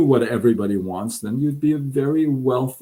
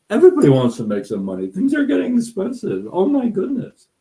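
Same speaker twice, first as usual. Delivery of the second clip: mocking wrong answer; 8 semitones higher than usual. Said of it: "The answer is not".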